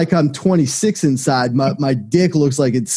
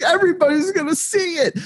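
mock crying whine; 14 semitones higher than usual. Whiny retold complaint, crying sound.

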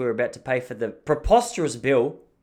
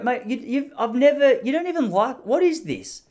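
exasperated retort; 10 semitones higher than usual. Retold or imitated. Retorting to friend's swirling.

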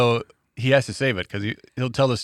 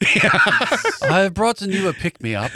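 slow, higher, formal pet voice; dog's voice; 6 semitones higher, much slower than usual. Pretend. Co-host voicing the dog giving Dad a bird. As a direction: play the proud dog presenting a gift, slowly.

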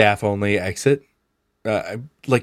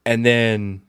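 normal talking voice

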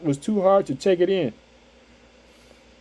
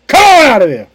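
loud, higher shout at the problem; commanding shout; 7 semitones higher, 11 dB louder than usual. Retold or imitated. Preacher voicing Jesus rebuking a problem, in a loud commanding shout. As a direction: order it out with force. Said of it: "That's what Jesus Christ said".

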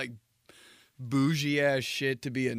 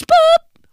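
shrill imitated tone beep; high beep; 17 dB louder than usual. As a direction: squeak out the beep.

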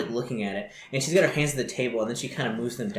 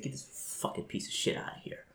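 cold whispered command; whispered order; 12 dB quieter than usual. Acted. Boss quietly ordering his men to drag him out. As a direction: hiss the order under your breath. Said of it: "he's like".

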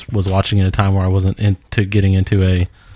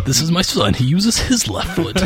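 high, slow theatrical ham voice; proud overacted parent announcement; hammy stage actor; 5 semitones higher, slower than usual. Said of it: "didn't overact as much as Daniel Day".

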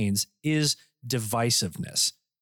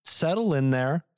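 normal talking voice

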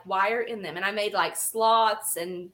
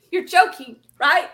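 incredulous; 7 semitones higher, 7 dB louder than usual. Retold or imitated. Her reply to critics.

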